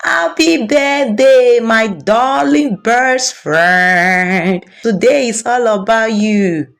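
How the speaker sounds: high, slow, sing-song birthday greeting; stretched, beaming happy birthday; grinning, gushing. A narrator's reading. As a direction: gush over her best friend with a big grin. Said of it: "grinning from ear to ear".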